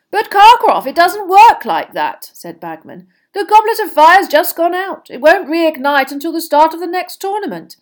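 high, loud, earnest explanation; excitable official, voice lifted; Bagman figure, protesting. Acted. Judge explaining the goblet to Karkaroff, protesting.

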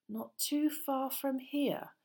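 slow, high, meaningful echo; significant repeat. Acted. Ron repeats it for Harry, slow and significant. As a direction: repeat it slowly, weighted with hidden meaning. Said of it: "Ron repeated, looking significantly".

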